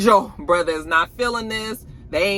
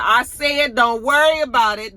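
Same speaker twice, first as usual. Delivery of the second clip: louder, higher snap to shut him down; snapping Auntie; 6 semitones higher, 5 dB louder than usual. Retold or imitated. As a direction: cut him off sharply. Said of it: "He said".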